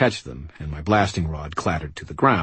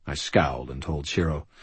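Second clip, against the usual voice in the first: ordinary delivery, nothing marked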